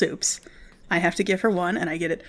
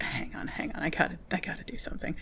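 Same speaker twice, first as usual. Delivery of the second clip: fast whispered aside; urgent whisper; 9 dB quieter than usual. Retold or imitated. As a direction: hiss it quietly so others won't hear. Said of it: "and I'm like".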